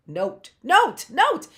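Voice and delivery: "note" said whole with no gaps, emphatic; blended word. Shown as a demonstration. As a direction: punch out "note" as one smooth word.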